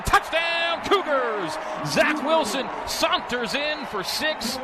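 high, slow, excited radio call; touchdown call. Real, not acted. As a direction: celebrate the score on air.

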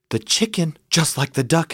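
plain reading voice

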